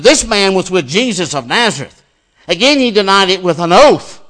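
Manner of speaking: loud, declamatory Bible reading; forceful scripture reading. A narrator's reading. Preacher reading Peter's denial to the congregation, forceful scripture reading. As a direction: proclaim the story, pushing the volume up.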